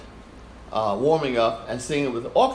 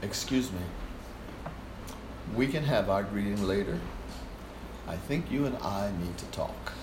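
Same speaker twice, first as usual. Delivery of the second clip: softer, measured and steady; calm firm Fred; 3 semitones lower, 6 dB quieter than usual. Retold or imitated. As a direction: quietly lay down the law. Fred calmly confronts conductor.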